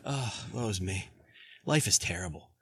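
hammed-up woe-is-me sigh; mock woe. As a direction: moan it like a self-pitying sufferer. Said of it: "We walk around sometimes like".

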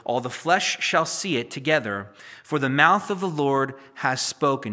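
loud, measured reading of scripture. Acted. Preacher reads Isaiah to his congregation, loud and solemn.

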